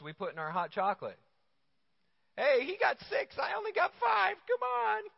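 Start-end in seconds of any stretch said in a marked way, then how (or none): 2.4-5.0 put-on high whiny kid complaining about marshmallows; whiny child. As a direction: whine it like a kid shorted one marshmallow. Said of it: "my children arguing".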